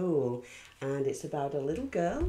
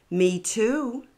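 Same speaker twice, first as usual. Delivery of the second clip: louder, higher, eager; eager boy; 6 semitones higher, 7 dB louder than usual. A narrator's reading. Stephen eagerly answering Sophie, louder and higher. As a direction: pipe up keenly. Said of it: "Stephen said".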